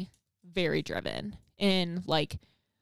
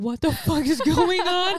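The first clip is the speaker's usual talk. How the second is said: higher, louder, baffled; shocked bystander; 9 semitones higher, 7 dB louder, slower than usual. Retold or imitated. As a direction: blurt it out, baffled. Imagined bystanders at stoplight.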